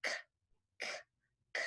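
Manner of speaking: "k" as isolated voiceless clicks; back-of-tongue K. Shown as a demonstration. Therapist showing tongue way back, "k" as short voiceless bursts. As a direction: release each "k" from the back of the tongue, no voice. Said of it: "way in the back".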